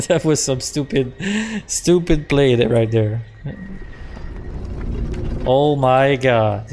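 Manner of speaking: ordinary delivery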